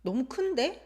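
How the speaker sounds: 큰데 rising at the end; rising intonation. Shown as a demonstration. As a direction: lift 큰데 like a question. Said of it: "it's always rising intonation".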